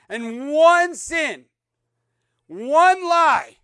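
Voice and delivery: high, loud and slow, each word struck; solemn punch on "one sin, one lie"; emphatic warning. Acted. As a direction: hit "one" hard both times. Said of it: "preacher's hammered emphasis".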